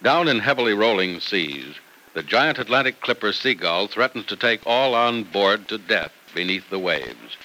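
normal talking voice

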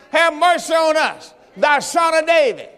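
crying out to be heard over the crowd; shouted plea. Acted. Preacher shouting the blind men's cry.

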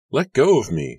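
high, loud frightened yell; narrated scream. A narrator's reading. Susanna to whoever grabbed her, high frightened yell. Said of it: "Susanna screamed".

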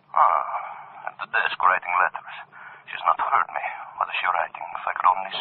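breathy whisper to himself; whispered aside. Acted. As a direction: whisper so she won't hear. Sneaking up on his wife.

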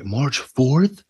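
high, loud surprised question; March fourth? asked in disbelief. Real, not acted. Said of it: "repeats date in surprise".

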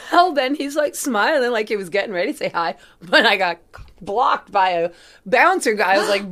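ordinary delivery

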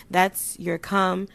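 usual speaking voice

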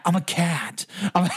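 loose Cage voice, barely holding laughter; goofy Cage cat line; Nicolas Cage impression. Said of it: "Critical analysis team, A K A cat".

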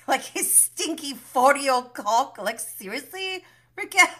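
high, incredulous mock scolding; acted exasperation. Imitating James scolding Raquel, incredulous exasperation. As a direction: push the pitch up, punch the name.

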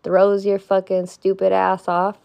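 ordinary talk, nothing marked

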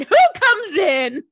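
loud, high mock-suspense announcement; dramatic build-up. Narrator builds up a dramatic entrance.

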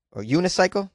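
usual speaking voice

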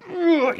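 high strained noise of grabbing; effort grunt. Retold or imitated. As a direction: strain as if yanking something. Acts out grabbing the cat. (gruñido agudo de esfuerzo)

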